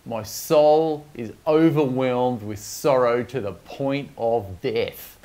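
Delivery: ordinary talk, nothing marked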